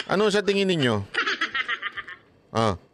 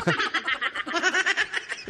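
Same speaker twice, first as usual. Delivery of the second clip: quick high giggle; high laugh; 10 semitones higher, 4 dB quieter than usual. Real, not acted. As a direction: laugh at the joke. Laugh at joke. (tawang mataas)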